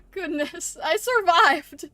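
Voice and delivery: loud, slow, drawn out relief; relieved. Real, not acted. Streamer after barely surviving, loud relief.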